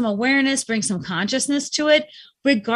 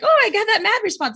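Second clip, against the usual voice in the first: higher, lightly surprised "oh" at her own anger; light curious self-talk; 8 semitones higher than usual. Shown as a demonstration. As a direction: notice the anger with mild amusement. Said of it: "I have to go like this".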